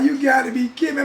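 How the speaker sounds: loud, high disbelief; shouting in disbelief at grown men running from rain; disbelieving shout. Retold or imitated. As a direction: yell it like you can't believe your eyes. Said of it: "I was like".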